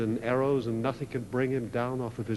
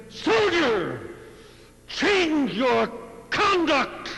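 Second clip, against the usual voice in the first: much higher, loud, slow final command; enraged king shouting down at the soldier; furious shout; 12 semitones higher, 6 dB louder, much slower than usual. A narrator's reading. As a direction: roar the order at the fallen soldier. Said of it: "the king exploded".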